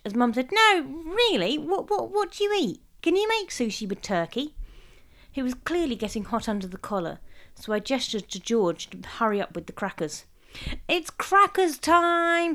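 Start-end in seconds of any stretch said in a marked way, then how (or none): 0.5-4.5 put-on high, tipsy, prying older woman; pry eagerly, a little drunk; tipsy nosy mum. Mum quizzing Derek, tipsy. 10.9-12.5 loud, slow, drawn-out call; loud announcement. A narrator's reading. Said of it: "said George loudly".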